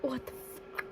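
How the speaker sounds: breathless whispered disbelief; stunned whisper. Real, not acted. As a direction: whisper it, floored. Just finished the book.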